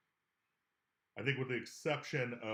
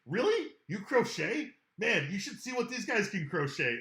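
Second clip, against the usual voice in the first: high, loud faked astonishment; mock amazement; 6 semitones higher, 8 dB louder than usual. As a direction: act wowed to win him over. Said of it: "and you're like".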